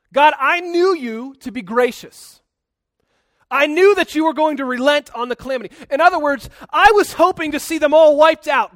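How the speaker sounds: loud, high-pitched complaint to God; hammed-up sulky outburst; pouting Jonah. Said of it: "Jonah's pouting here".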